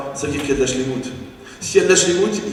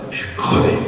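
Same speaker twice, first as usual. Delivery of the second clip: mock exhausted sigh; 4 dB louder than usual. Tired people mimicked.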